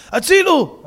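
high loud yell; drowning man screaming; desperate call; cry for help. Acted. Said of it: "הוא צועק".

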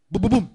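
loud, punched בובום like a pounding heart; heartbeat thump. Retold or imitated. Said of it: "הלב שלו מתחיל לעשות לו".

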